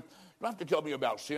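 ordinary talk

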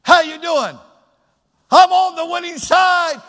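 loud, high, fired-up preacher's shout; triumphant reply. Shown as a demonstration. Preacher models the answer to how you doing, triumphant shout. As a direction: proclaim it at full voice, high. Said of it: "Have your voice trained to say".

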